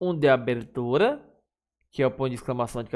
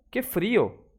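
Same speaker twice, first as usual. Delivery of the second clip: higher, mild shiver of cold; feeling the cold; 6 semitones higher than usual. Shown as a demonstration. Teacher showing an exclamation of sensation, mock cold. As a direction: complain lightly that it's freezing.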